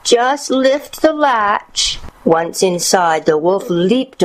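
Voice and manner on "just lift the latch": high, drawn-out granny voice; slow, high old-lady instruction; frail grandmother. A narrator's reading. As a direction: call to the visitor very slowly, pitched high. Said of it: "Grandmother responded".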